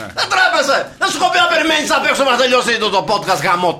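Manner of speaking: high, loud, rapid mock tirade; mock cursing out. Host scolds coffee delivery man for interrupting the podcast, mock-angry shouting. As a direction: berate him in hammed-up outrage, half laughing. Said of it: "να τον βρίσω χιδέα".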